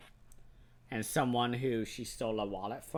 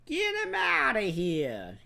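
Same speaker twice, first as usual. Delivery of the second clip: high, slow, hammed-up Italian mobster order; louder, stretched-out mobster impersonation; 11 semitones higher, 6 dB louder than usual. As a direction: bark it like a cartoon Italian mob boss. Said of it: "He turns into an Italian mobster".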